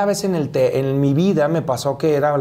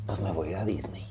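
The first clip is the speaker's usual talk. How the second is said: very soft, lower whisper breaking into a laugh; 7 semitones lower, 13 dB quieter than usual. Retold or imitated. Broke actor deciding on Disney, whispered through laughter. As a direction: whisper the silly plan, half laughing.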